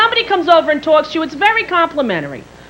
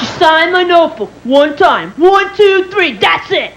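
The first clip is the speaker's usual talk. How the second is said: higher, emphatic mock kid voice; bragging child; 4 semitones higher, 4 dB louder, slower than usual. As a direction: boast loudly like a nine-year-old. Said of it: "you're bragging".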